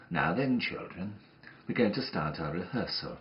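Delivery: usual speaking voice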